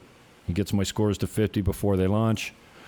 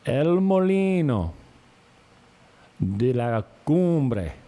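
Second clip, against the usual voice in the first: high, drawn-out Spanish name; showy announcement; 6 semitones higher, 4 dB louder, slower than usual. Shown as a demonstration. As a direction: belt it out slowly like an announcer.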